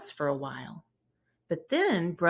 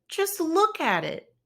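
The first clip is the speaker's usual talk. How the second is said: high, louder upset wail; cried out; 11 semitones higher, 4 dB louder than usual. Acted. Brother protesting to Papa about his ruined bike, upset. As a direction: plead in distress. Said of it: "cried brother".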